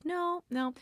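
high, soft, polite brush-off; imitating a very polite no; polite refusal. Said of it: "very politely is like".

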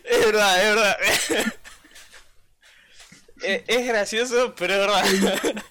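entre risas, más fuerte; riendo. Real, not acted. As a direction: dice verdad riéndose. Riendo tras leer la frase. (riéndose a carcajadas)

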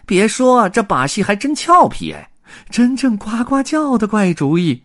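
very high, loud character voice; chattering onlooker. A narrator's reading. Circus folk all chip in about the pig, chattering. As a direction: pipe up excitedly from the crowd. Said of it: "大家同时七嘴八舌地议论起来".

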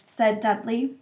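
ordinary delivery

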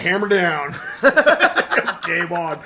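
a cheeky fake apology; playful bump-and-sorry; gleeful mock bash. Acting gleeful bumping game.

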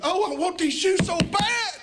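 put-on high, yearning begging child voice; child swooning over shoes; desperate child. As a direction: pour out the longing like a kid who must have them. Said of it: "You want these shoes?".

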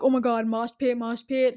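lightly mocking teen boy voice; imitating teenage boys. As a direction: do a hint of an over-eager festival kid. Mimicking annoying teenage boys chanting.

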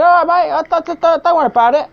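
high chirpy welcome to a latecomer; cheery MC. Retold or imitated. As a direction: brightly wave the late bloke on, mate. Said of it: "I said".

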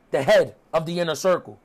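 normal talking voice

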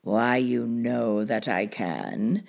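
low, slow cartoon cat voice; deep, leisurely show-off cat; boastful cat. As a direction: brag slowly, deep and easy.

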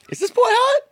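squeaky high mock girl voice; silly high girly voice. Reading a friend's text to a podcast co-host in a squeaky girly voice. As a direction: pitch it way up like a giggly girl. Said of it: "that type of a voice".

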